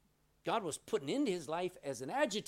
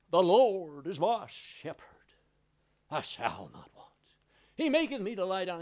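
flowery recital; 4 semitones higher, 8 dB louder than usual. Retold or imitated. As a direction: recite like a showy theologian. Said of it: "he was very flowery and wonderful".

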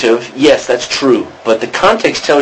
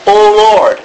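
high, loud heartfelt call to the Lord; fervent appeal, pitched up and louder; emphatic and heartfelt; 8 semitones higher, 4 dB louder than usual. Shown as a demonstration. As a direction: cry it out from the heart. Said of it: "you're saying it very emphatically and from the heart".

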